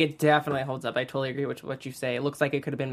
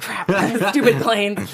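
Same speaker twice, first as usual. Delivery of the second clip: slow, louder, laughing complaint; laughing mock grumble; 6 semitones higher, 8 dB louder, slower than usual. Grumbling about a loud plane.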